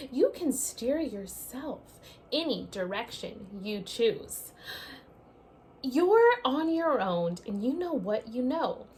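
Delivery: lively storybook read-aloud; reading to children. Acted. Teacher reading a picture book to her class, lively read-aloud. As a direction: read it out like story time for little ones.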